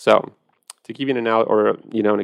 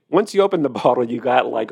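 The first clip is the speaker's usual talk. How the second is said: ordinary talk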